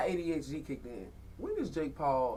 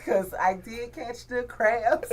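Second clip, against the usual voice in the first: slow, high, louder quote broken by laughter; laughing quote; 7 semitones higher, 6 dB louder, much slower than usual. Retold or imitated. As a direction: laugh through her words as you repeat them. Laughing while quoting her again.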